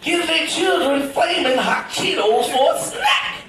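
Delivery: high, slow, outraged disbelief; slow scandalized squeal at the parent; mock outraged thought. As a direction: play up the judgmental inner voice. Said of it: "in my mind, I'm thinking".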